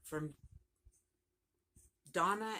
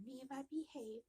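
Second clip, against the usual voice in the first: a hint of a high, soft mother's scold; imitating mom; 9 semitones higher, 8 dB quieter than usual. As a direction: lightly voice her mom telling her to behave, softer. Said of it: "I can hear her voice right now going".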